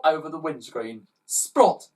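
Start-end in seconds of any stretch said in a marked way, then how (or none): 1.3-1.9 splot. A narrator's reading. Sound effect.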